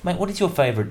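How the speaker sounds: ordinary delivery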